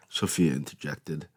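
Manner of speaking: usual speaking voice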